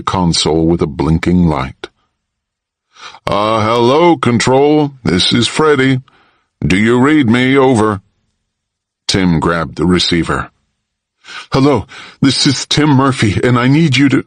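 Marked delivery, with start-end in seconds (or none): 3.3-8.0 higher, lightly voiced sailor calling on the radio; lightly voiced captain hailing Control. A narrator's reading. 11.5-14.2 put-on higher, eager boy's voice; a kid trying to sound official; lightly voiced young boy asking urgently; boy on radio. A narrator's reading. Boy calling ship on radio.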